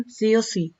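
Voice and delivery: ordinary delivery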